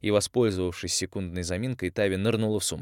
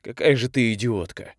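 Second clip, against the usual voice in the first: louder angry snarl at her; angry growl; 4 semitones higher, 6 dB louder than usual. Acted. Young man insulting the girl, angry snarl. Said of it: "прорычал Андрей".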